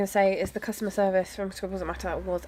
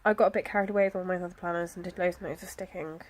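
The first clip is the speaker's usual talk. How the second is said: ordinary talk, nothing marked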